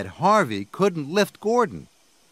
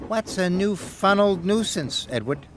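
high little peep; Percy's higher peeping question; 6 semitones higher than usual. A narrator's reading. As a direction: ask it like a small curious engine. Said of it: "peeped Percy".

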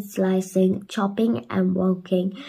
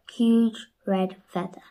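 ordinary delivery

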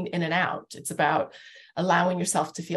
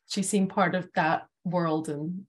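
ordinary talk, nothing marked

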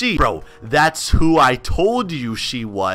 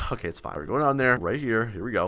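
much softer and lower; hushed; 6 semitones lower, 8 dB quieter, faster than usual. Real, not acted. Gamer creeping into hidden room, hushed. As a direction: tiptoe voice, steadying himself.

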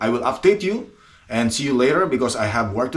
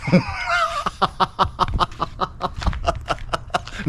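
high, drawn-out staccato giggle; long run of high giggles at himself; giggling; 8 semitones higher, 3 dB quieter than usual. Real, not acted. Vlogger embarrassed by his own English, giggling.

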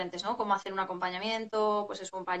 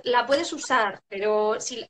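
ordinary delivery

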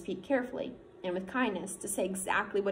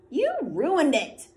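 slow, louder, higher annoyed complaint; irritated; 7 semitones higher, 8 dB louder, much slower than usual. Acted. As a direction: blame him, drawn out and sharp. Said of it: "in an irritated voice".

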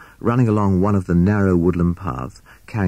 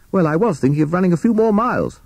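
Captain Beaky; 11 semitones higher, faster than usual. A narrator's reading. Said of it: "said Captain Beaky".

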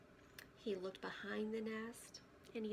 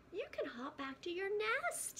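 high encouraging frog voice; 10 semitones higher, 3 dB louder than usual. Acted. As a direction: cheer her on.

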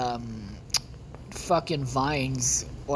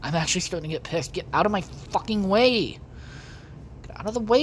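normal talking voice

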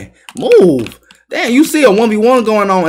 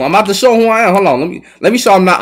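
normal talking voice

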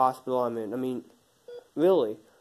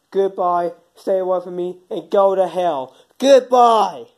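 angry goodbye shout; 3 semitones higher, 9 dB louder than usual. Acted. Man throws his friend out of his life, shouting goodbye. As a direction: slam the door with your voice.

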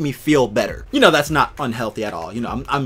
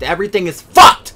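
loud, raised frustrated exclamation; upset outburst; 4 semitones higher, 11 dB louder than usual. Real, not acted. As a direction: burst out in real frustration. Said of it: "I was upset".

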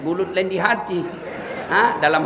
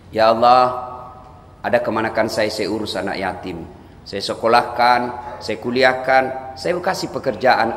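lightly voiced pious uncle; humble prayer; 9 semitones lower than usual. Shown as a demonstration. Man reminding God of his good deeds, lowly and humble.

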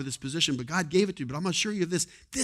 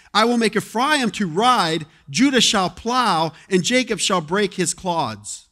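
louder, slow proclaiming scripture read; preached scripture; 2 semitones higher, 8 dB louder, slower than usual. Acted. Preacher proclaims Hosea to the church, loud and slow. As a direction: proclaim each verse, slowing as it builds.

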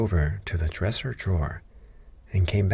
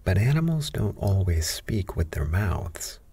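usual speaking voice

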